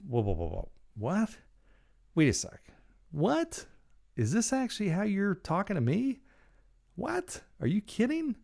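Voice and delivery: stunned, can't believe he's talking this way; incredulous disbelief. Retold or imitated. Telling the rude transport driver off, in high incredulous disbelief. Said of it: "And I'm like".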